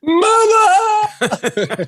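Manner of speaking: high-pitched wail for mother; mock battlefield scream. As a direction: scream like a hammed-up terrified soldier. Wounded soldier crying for mother.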